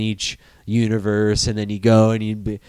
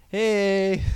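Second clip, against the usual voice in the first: put-on a higher, hint-of-a-kid "hey"; lightly voiced eight-year-old nephew; 6 semitones higher than usual. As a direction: play a little kid saying hi, lightly. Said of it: "talking to my eight-year-old nephew".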